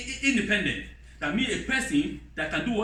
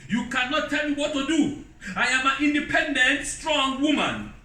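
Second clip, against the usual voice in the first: mocking defiant wife; 6 semitones higher than usual. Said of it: "she never come and say".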